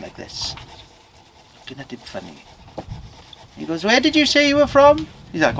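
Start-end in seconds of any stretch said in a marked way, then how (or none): 3.8-5.1 high, rising curious query; probing question. Acted. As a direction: blacksmith quizzing the stranger, voice pitched up. Suspicious blacksmith questions farmer.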